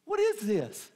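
bewildered passenger voice, rising to a squeal; shocked friends. Retold or imitated. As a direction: act out the friends' disbelief. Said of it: "And they're like".

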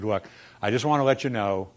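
usual speaking voice

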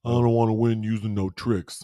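loud dopey boxer voice; dopey Rocky voice. Retold or imitated. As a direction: play the dim stubborn boxer. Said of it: "what does Rocky's dumbass say".

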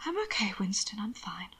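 very soft whisper, fading away. A narrator's reading. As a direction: whisper reassurance to a worried dog.

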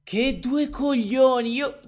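slow, high, loud wail; drawn-out yelled curse; exasperated outburst. Real, not acted. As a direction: let the held-back frustration burst out. Outburst after trying not to yell.

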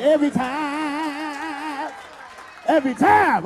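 drawn-out hype-man repeat; crowing echo. Retold or imitated. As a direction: stretch it out, then punch it. Hypes audience answer to room.

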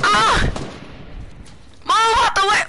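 high, loud yell to clear way; panicked shout. Real, not acted. Under fire, yelling.

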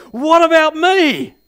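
put-on loud, high cry of a hurt child. Preacher acting out a boy crying out for his share, loud. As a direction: shout it like a little boy who can't get served. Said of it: "he just cries out at the top of his voice".

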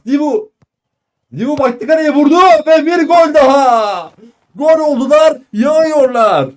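high, loud goal celebration; screaming football commentator. Real, not acted. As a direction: shout the goal call. Goal call in live commentary. (bağıran futbol spikeri)

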